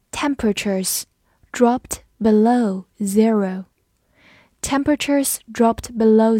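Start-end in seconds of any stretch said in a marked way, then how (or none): 0.1-3.7 slow, words set apart; slow read-along. Shown as a demonstration. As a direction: model the sentence slowly for learners. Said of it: "我们慢读一遍". (慢速分词领读)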